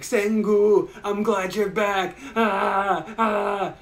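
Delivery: high, loud, slow blubbering; hammed-up sobbing reunion; mock crying. Big oaf sobbing over his friend Senku, hammed-up. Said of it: "he's crying".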